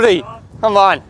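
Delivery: loud, high victory shout; cheering. Real, not acted. Player cheering his own shot, loud high shout. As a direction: punch it out like a celebration.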